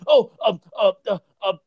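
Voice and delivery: high, stammering guess blurted out; mock a friend fumbling for the answer; Joe cutting in. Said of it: "Joe will cut me off and say".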